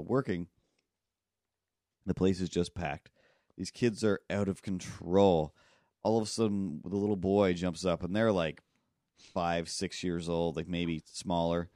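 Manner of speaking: ordinary delivery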